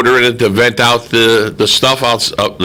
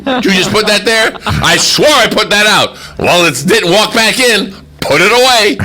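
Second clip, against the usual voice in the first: higher, fast, bickering voices; mock couple arguing; 9 semitones higher than usual. Host acting out a couple bickering over the garbage, high voices. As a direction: play both sides of a petty spat, pitched up.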